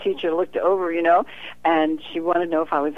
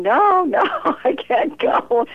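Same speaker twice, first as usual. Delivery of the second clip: high, louder, slow protest with a laugh; mock refusal; 10 semitones higher, 5 dB louder, slower than usual. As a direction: whine it like a reluctant child. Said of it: "And I'm going".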